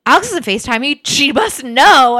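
dramatize a friend's alarm; mock alarm. Friend fearing the surprise is blown, acted alarm.

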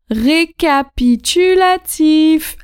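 récapitulatif drawn out slowly syllable by syllable; teacher voice announcing the recap; slow emphatic. Shown as a demonstration. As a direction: stretch out récapitulatif. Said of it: "announcing recap slowly".

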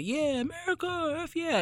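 mock patriotic cheer. Mimicking Americans hyped on their greatness, high mock cheer.

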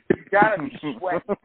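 mock outraged question; incredulous yell. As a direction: shout it at the jacket guy. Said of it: "And it's like".

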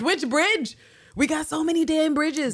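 high, loud exasperation; baffled New Yorker venting; incredulous rant. Retold or imitated. Ranting at a writing mistake.

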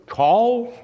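mock German accent, pitched high and rising. Pastor imitates his neighbor calling him, German accent. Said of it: "Is that a good German accent?".

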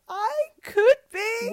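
high, slow and wistful; high hopeful squeak. Retold or imitated. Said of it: "I'm like".

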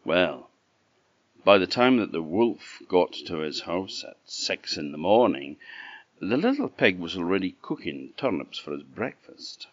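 usual speaking voice

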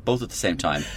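normal talking voice

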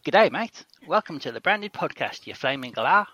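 a cheery Australian voiceover greeting; Australian accent. Acted. Second take of the ad for Australasian listeners, Australian accent. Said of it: "That's actually really good".

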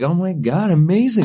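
gushing excitement. Retold or imitated. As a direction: gush over the boat. Girlfriend delighted about boat.

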